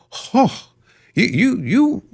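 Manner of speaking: high-pitched stammering mock outrage; hammed-up affronted stammer; mock indignation. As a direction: sputter it high, as if scandalised. Mimics an indignant partner in mockery.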